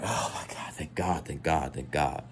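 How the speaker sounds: whispered thank God; relieved whisper. Retold or imitated. Seeing her laugh, whispered relief. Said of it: "I'm like".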